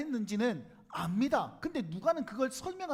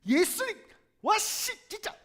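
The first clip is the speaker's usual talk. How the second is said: high, loud, fed-up yelling; exasperated shout; 9 semitones higher, 9 dB louder than usual. Retold or imitated. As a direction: snap at the Master in frustration. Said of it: "베드로가 짜증내잖아요".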